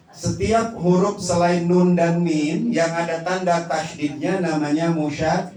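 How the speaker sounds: ordinary talk, nothing marked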